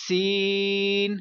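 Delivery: سین drawn out with a whistling hiss; teacher makes سین hiss; whistled s. Shown as a demonstration. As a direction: let سین whistle, slow and long. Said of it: "سین پر بھی سٹی نکالوں گا".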